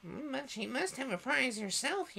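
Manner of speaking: very high squeaky mouse. A narrator's reading. Mouse objecting to the party, in high mouse voice. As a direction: pipe up high like a little mouse. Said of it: "said the mouse".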